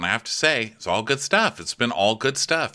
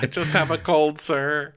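higher, timid voice; mock meek patient; 8 semitones higher than usual. Host voicing a patient objecting. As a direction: plead like a worried patient. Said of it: "I'm like".

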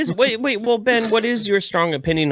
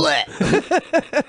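disgusted blech; 11 semitones higher than usual. Real, not acted. Disgust noise.

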